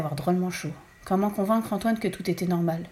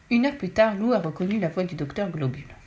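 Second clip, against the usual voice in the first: ordinary delivery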